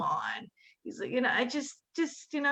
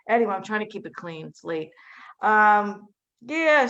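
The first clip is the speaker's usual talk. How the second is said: normal talking voice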